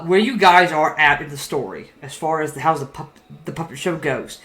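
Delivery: ordinary delivery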